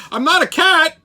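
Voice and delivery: high, loud squeak of a flustered cat lawyer; squeaky kitten lawyer. Retold or imitated. Retelling the lawyer stuck in a cat filter, high squeaky protest. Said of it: "He goes".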